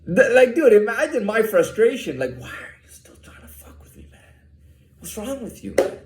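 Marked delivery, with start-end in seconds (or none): 2.4-6.0 hushed, unvoiced whisper of a frustrated question; whispered quote. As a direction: whisper it as if muttering under your breath. Retold quote of his own frustration.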